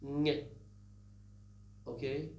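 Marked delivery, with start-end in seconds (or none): 0.0-0.4 NG as one velar nasal sound; demonstrated ng sound. Shown as a demonstration. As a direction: hum NG at the back of the mouth, no separate G. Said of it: "The pronunciation is".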